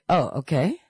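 ordinary talk

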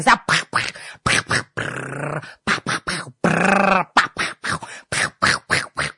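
breathy pa pa sound effects, fading; mock popping noises. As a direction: imitate things popping. Mimicking everything popping at home.

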